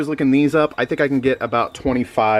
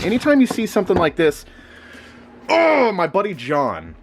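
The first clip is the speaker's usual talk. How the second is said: ordinary delivery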